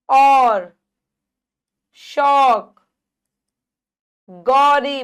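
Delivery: और, शौक, गौरी with loud wide औ; loud, held औ in और, शौक, गौरी; open-mouthed vowel. Shown as a demonstration. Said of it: "बड़ा मुंह खुल रहा है".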